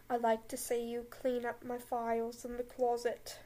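Snape line slipping into Australian; accidental Australian accent. Acted. Snape line that slid into an Australian accent. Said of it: "Am I an Australian now?".

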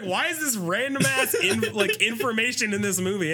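high, incredulous mock complaint; baffled. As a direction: squeak out your confusion. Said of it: "I was like".

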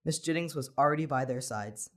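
usual speaking voice

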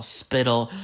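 ordinary talk, nothing marked

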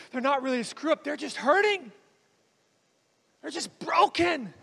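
ordinary talk

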